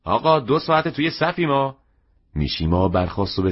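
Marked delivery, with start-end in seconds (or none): 0.0-1.8 high-pitched, restless grumble from a customer; impatient complaint. A narrator's reading. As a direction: complain from the queue, pitch jumping right up. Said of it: "بیقرار شده بودند و گله کردند".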